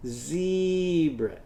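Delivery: zebra drawn out to stress the e sound; a teacher isolating the vowel; stretched zebra. Shown as a demonstration. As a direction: lean into the e in zebra. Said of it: "what do you hear after that".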